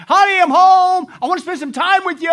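called out high and loud, eager; loving spouse calling. Acted. Pastor acts the spouse arriving home, loud eager call. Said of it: "in your relationship with your spouse".

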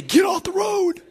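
high, drawn-out mock holler; calling out. Preacher prompting listeners to warn elderly drivers, mock holler. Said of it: "turn to somebody and say".

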